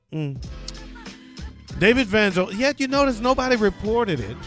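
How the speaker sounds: ordinary talk, nothing marked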